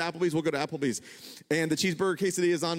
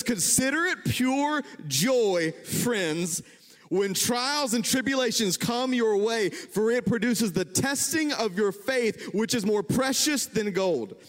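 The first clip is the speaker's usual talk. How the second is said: scripture reading; 4 semitones higher, slower than usual. Acted.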